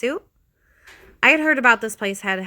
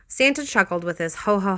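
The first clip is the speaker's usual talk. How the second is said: plain reading voice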